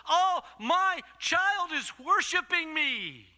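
high, loud, drawn-out exclamation of a proud father; exultant divine papa; delighted Papa God. Acted. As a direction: proclaim it slowly with fatherly delight. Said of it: "Your Papa God goes".

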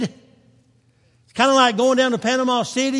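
ordinary talk, nothing marked

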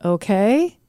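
rising okay seeking permission; questioning okay. Shown as a demonstration. Parent tagging a command, questioning okay. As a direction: ask okay? with an upward lilt. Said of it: "You're asking permission".

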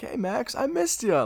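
high-pitched mock hero greeting an old foe; imitated fond greeting. Spider-Man greeting his old villain Max, high-pitched imitation.

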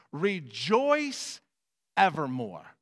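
"rejoice evermore" proclaimed loud, stretched; King James reading. Acted. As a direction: read it like the old King James. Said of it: "I'm gonna give it to you in the King Jimmy version".

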